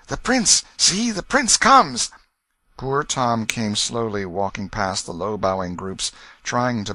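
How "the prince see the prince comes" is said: high, loud exclamation, stretched at the end; excited courtiers' buzz. A narrator's reading. Courtiers spotting the prince coming along, high excited call. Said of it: "there was a general buzz".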